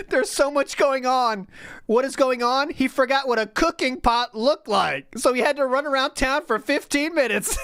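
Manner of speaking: high, laughing mockery of the streamer; mock whiny retelling, laughing. Retelling her favorite line, laughing high mock voice. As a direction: squeal it out through laughter. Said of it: "That's my favorite".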